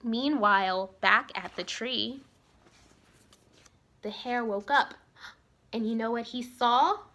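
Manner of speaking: lively storybook reading voice; story narration. A narrator's reading. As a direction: read it like a bedtime story for kids. Reads story to children.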